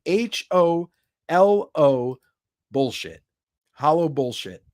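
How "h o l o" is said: slow letter-by-letter spelling; spelled out slowly. Shown as a demonstration. Spelling the hashtag slowly for chat. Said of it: "One word".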